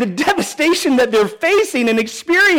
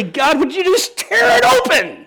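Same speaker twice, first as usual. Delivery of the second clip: pleading prayer; 7 semitones higher than usual. Retold or imitated. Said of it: "his prayer is literally".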